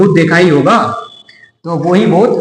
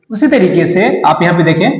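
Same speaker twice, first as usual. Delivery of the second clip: usual speaking voice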